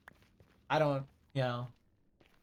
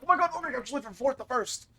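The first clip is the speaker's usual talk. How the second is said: high, fast mock hype call; hyped commentator; 11 semitones higher, 3 dB louder, faster than usual. Streamer imitating the anime's race call. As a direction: rattle it off like a thrilled race caller. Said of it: "they're like".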